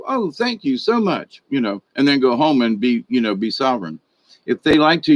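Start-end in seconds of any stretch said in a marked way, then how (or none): 0.1-1.4 high, sugary mock gratitude; sweet fake thanks. Speaker showing viewers how to fool the enemy, sweetly insincere. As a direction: pretend sweetness through a smile, pitched up. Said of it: "smile sweetly and go".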